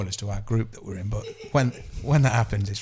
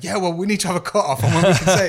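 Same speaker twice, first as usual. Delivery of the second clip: defensive quick rant; 6 semitones higher, 5 dB louder, faster than usual. Retold or imitated. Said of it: "It's like".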